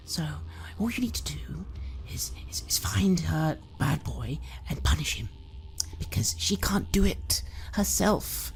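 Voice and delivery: high, hushed coaxing with a sweet edge; creepy whispering mother. Acted. Mother whispering to her son how to kill the boy. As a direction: whisper the murder like a bedtime secret.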